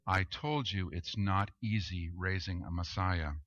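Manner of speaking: a hint of a weary mother; understated resigned mother's quip; resigned shrug. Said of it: "shrugs her shoulders, and says".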